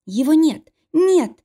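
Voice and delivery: high-pitched, distressed denial; desperate insistence. A narrator's reading. Cornered wife tells husband it is gone, desperate denial. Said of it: "твердила Кантана".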